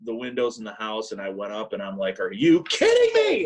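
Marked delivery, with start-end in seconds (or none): 2.2-3.4 much higher, louder disbelieving shout; incredulous yell. Retold or imitated. Son yelling at sleeping mom over gas burners left on, incredulous shout. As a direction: blast it in her face, pitched way up.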